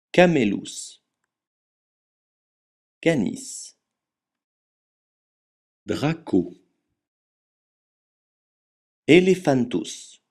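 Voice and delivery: each Latin animal name said alone, with a pause after it for repeating; "Aquila" said as akwila and "Equus" as ekuus, every letter sounded; Latin practice drill. Shown as a demonstration. Teacher drilling students on Latin animal names, clear and deliberate. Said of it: "Entraînons-nous maintenant à prononcer le latin".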